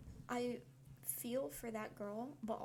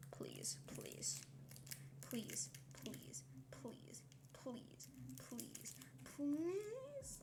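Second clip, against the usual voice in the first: whispered pleading; 5 semitones higher, 6 dB quieter, much slower than usual. Real, not acted. Begging the wrapper to open.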